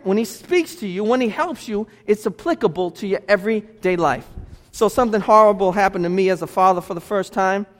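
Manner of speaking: usual speaking voice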